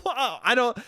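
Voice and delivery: high-pitched cackle breaking into words; retold hysterical laughing. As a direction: act out helpless nervous laughter. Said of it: "I just start laughing hysterically".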